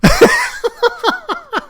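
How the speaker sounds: loud, high, real laugh; burst of laughter. Real, not acted. Game master laughing at a player's outburst, loud and high. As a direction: crack up at the joke.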